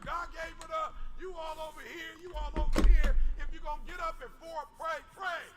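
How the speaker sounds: loud, high rousing sermon; full-force preaching. Acted. Preacher exhorting the congregation to pray, full force.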